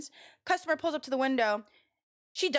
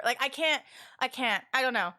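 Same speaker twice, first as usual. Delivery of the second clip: ordinary delivery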